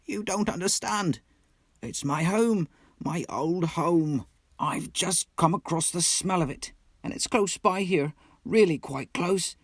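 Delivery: high, aching plea, slowing on please; anguished pleading. A narrator's reading. Mole begs the Rat to turn back home, high and pleading. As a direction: beg your friend to come back. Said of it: "pleaded the poor mole in anguish".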